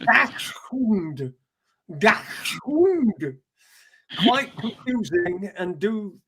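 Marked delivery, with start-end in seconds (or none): 0.0-1.3 "Dachshund" said as "ashhund" in a mock Swedish accent; light Swedish-accented "Dachshund". Shown as a demonstration. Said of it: "a Swedish accent". 2.0-3.4 "Dachshund" said as "dahund", higher, mock Swedish; lightly Swedish "Dachshund", no ch sound; Swedish accent. Shown as a demonstration. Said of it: "a Swedish accent".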